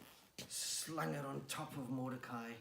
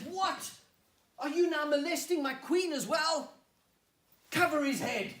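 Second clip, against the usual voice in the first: high, roaring indignation; furious royal shout; enraged king; 12 semitones higher, 12 dB louder than usual. Acted. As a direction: bellow the accusation, then the order. King catches villain grabbing queen.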